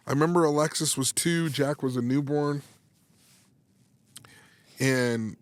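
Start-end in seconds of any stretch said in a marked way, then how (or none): none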